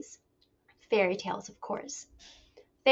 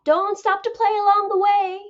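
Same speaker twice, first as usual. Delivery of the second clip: mother calling out; 10 semitones higher, 7 dB louder than usual. A narrator's reading. Mother's warning continues.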